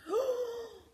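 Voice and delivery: high, sharp intake of breath; frightened gasp. A narrator's reading. Ted hears of something ahead, whimpering gasp. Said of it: "whimpered Ted".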